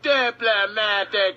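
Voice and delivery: put-on slow, drawn-out South African accent on "Diplomatic"; mock South African accent; South African villain. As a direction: say "Diplomatic" slowly like the Lethal Weapon villain. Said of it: "he's just like".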